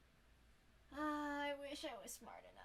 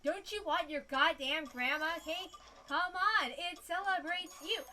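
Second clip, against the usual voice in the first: loud, emphatic, swooping sales plea; insistent coaxing; 3 semitones higher, 7 dB louder, slower than usual. Pretend. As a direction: push the cake on a reluctant customer. Pushing cake on game customer.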